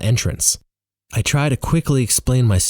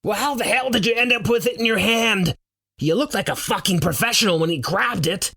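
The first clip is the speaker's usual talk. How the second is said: high, agitated shouted retort; shouting back; 13 semitones higher than usual. A narrator's reading. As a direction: fire back in exasperated alarm. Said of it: "Roar shouts back".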